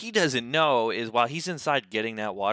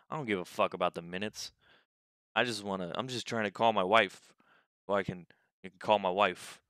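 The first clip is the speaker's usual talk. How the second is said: quieter mumbled repeat; 5 semitones lower, 6 dB quieter than usual. Retold or imitated. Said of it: "he just keeps repeating it".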